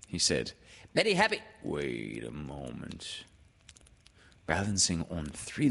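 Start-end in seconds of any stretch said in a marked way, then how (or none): 0.9-1.4 high, loud piping voice; eager little-creature squeal; Piglet. A narrator's reading. Piglet starts greeting, is cut off. 1.6-3.2 low, slow, soft donkey voice; weary, dragging interruption; Eeyore. A narrator's reading.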